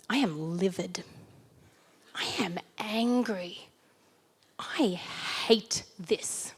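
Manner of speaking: hushed, slow mock seething whisper. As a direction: read the furious email under your breath, slowly. Client's angry email read aloud.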